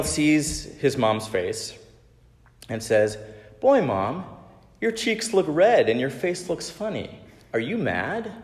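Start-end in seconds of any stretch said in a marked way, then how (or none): 3.6-8.3 lightly voiced child, higher pitch; child character's questioning. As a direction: ask Mom in a raised, sing-song kid voice. Voices young Daniel questioning his mom.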